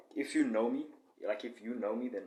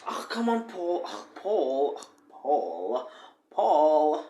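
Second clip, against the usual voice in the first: put-on teasing girl's whine; 5 semitones higher, 9 dB louder, much slower than usual. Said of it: "the girls with me is like".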